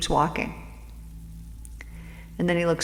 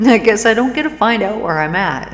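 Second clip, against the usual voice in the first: louder, chuckling through the words; laughing resignation; 4 semitones higher, 8 dB louder than usual. Acted. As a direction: give up and laugh it off. Traveler gives up, laughing along.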